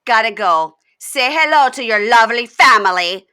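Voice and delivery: mock-sweet goodbye dripping with contempt; sarcastic brush-off. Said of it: "looks at him and goes".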